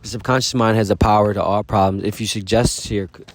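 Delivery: ordinary talk, nothing marked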